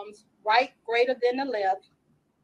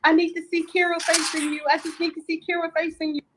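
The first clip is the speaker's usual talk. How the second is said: higher pleading voice; quoting a boy; 7 semitones higher, faster than usual. Retold or imitated. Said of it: "And he was like".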